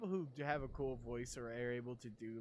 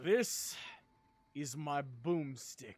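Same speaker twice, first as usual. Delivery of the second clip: slow, loud movie-hero boast; playful action movie line, a touch louder; mock action hero; 4 dB louder, much slower than usual. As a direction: drawl it out like a tough guy with a shotgun. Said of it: "your voice fits that".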